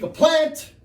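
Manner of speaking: loud, high-pitched burst; emphatic exclamation. Acted. Lecturer telling audience what tobacco is, emphatic exclamation.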